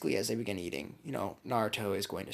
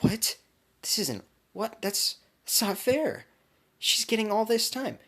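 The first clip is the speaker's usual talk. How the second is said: put-on high, slow, whining protest from jealous girls; a breathy gasp of a start, then a drawn-out, high-pitched jealous whine; jealous fangirls; 12 semitones higher, 6 dB louder, slower than usual. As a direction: wail it like a fangirl who just lost out. Girls watching, dropping in jealousy.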